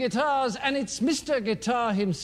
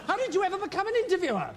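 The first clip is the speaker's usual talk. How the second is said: exasperated; 6 semitones higher, much faster than usual. Acted. Exasperated rapid question.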